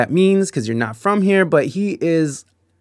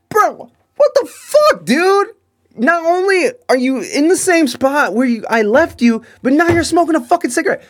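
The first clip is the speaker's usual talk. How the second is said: much higher, louder outburst with a laugh-cry; outraged disbelief; 14 semitones higher, 4 dB louder than usual. Retold or imitated. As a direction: explode in disbelief at the lit cigarette. Finds him smoking indoors.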